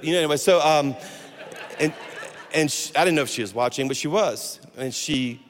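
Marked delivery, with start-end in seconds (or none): none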